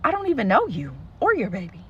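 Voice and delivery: high disbelieving pitch jump; incredulous retort. Retold or imitated. Storyteller retorting to the accuser, high incredulous. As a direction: throw it back in disbelief. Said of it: "I'm like".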